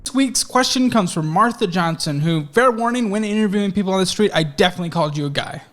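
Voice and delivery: loud, projected presenter read; mailbag read. Real, not acted. Host reads Martha's question for the show, projected presenter style. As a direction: read the letter out loud to an audience.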